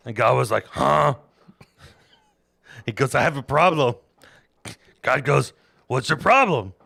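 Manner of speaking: loud, high comic Bible retelling; boisterous mock-epic narration; hammed-up storytelling. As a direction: tell it like a rowdy campfire tale.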